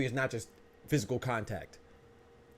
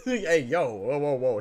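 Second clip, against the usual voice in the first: loud laughing whoa; shocked laugh; 4 semitones higher, 8 dB louder, slower than usual. Real, not acted.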